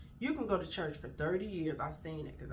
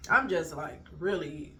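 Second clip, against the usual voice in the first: ordinary delivery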